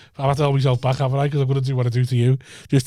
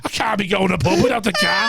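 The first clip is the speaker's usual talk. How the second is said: higher, shouted mock reply; 7 semitones higher than usual. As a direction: bellow the daft excuse. Mocking the driver's answer.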